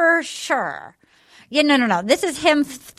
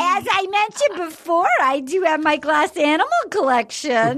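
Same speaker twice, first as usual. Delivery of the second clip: raised-pitch, hammed-up formal announcement; mock awkward dater; 4 semitones higher than usual. Joking dater revealing a glass menagerie, mock-formal voice. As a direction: announce it as a mock-earnest oddball.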